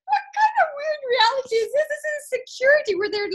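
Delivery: amused disbelief. Retold or imitated. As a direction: marvel at the weird moment. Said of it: "I was like".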